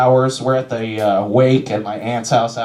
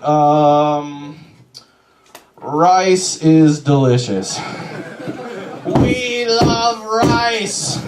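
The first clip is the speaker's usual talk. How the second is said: serene Buddhists; 2 semitones higher, much slower than usual. Retold or imitated. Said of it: "They would just be like".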